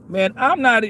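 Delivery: ordinary talk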